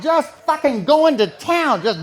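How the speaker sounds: loud, high, near-hysterical build; shouting. Real, not acted. Comic telling the wild climax, shouting. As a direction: yell it as it spirals out of control.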